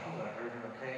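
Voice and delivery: soft, offhand retold check-in; feigned casualness. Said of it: "I don't want him to know".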